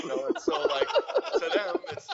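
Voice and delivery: high laughing squeal. Real, not acted. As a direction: laugh through the words. Laughing, high excited bit.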